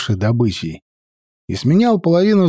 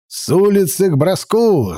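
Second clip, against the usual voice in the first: raised sharp order to throw; barked command; 5 semitones higher than usual. Acted. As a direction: call the volley. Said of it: "скомандовал ярл Олаф".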